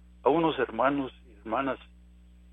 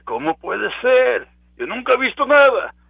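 doubter's cry; 9 semitones higher, 8 dB louder than usual. Acted. Preacher voicing doubter, rising.

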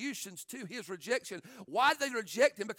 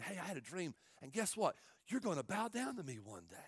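hushed boast; 3 semitones lower, 7 dB quieter than usual. Acted. Younger brother's boast, hushed.

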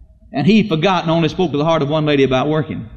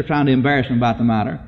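ordinary talk

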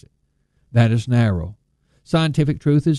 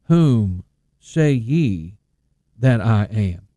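very slow Whom say ye with weight; preacher quoting Jesus, slow; slow solemn question; 3 semitones lower, slower than usual. Retold or imitated. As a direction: draw out the question to the crowd. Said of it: "Jesus asked the question".

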